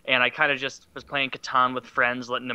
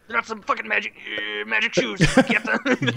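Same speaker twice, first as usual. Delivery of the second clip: Rick voice; 7 semitones higher than usual. Retold or imitated. Voicing Rick to Morty.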